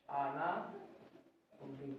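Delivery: अनावृत्ति very slow, low and soft; slow dictation. Shown as a demonstration. Teacher telling pupils what to write, slow dictation. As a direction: stretch out अनावृत्ति quietly, part by part.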